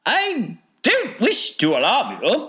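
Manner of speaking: high, loud falsetto; apologetic Wonka. A narrator's reading. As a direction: break bad news in a piping voice. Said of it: "said Mister Wonka".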